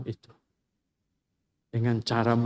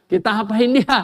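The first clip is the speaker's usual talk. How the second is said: higher, louder, with a gleeful laugh; mocking laugh; 8 semitones higher, 7 dB louder than usual. Brothers mocking Yusuf before selling him, gleeful laugh.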